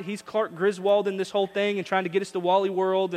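ordinary talk

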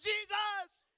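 very high, startled yelp; high comic cry of alarm; mock terrified cry. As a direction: act out jumping in panic at a noise. Startled by horn, retold.

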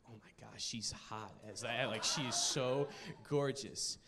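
low, soft acted swoon; hushed awe. Boy watching his crush walk out, acted hushed awe. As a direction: murmur it low, as if awestruck.

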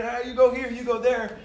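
loud, high barked directions; retold screaming. Coach on the sideline screaming directions at kids, loud and high. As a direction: shout positions at the players. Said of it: "I'm screaming".

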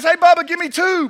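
high, loud eager call; excited Jethro. Acted. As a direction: call out to your buddy, thrilled. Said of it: "Jethro looks at Bubba and he says".

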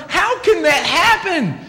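high, slow, laughing disbelief; bewildered. Retold or imitated. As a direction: play baffled at how pretty turns ugly. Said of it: "you're looking at it and going".

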